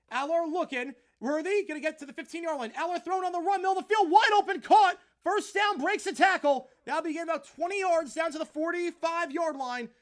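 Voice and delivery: very loud, high call of a wide-open catch; excited play-by-play. Acted. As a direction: scream the big play like a broadcaster.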